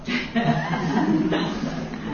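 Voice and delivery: breathy laugh. Real, not acted. Laughs at audience doubt.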